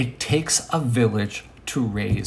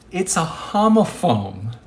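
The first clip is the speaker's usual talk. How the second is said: ordinary delivery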